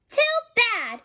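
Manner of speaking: high, sharp little "Too bad"; defiant retort. Little sister snapping at her brother, defiant high retort. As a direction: toss it back at him with a glare. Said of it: "she told him with her glare".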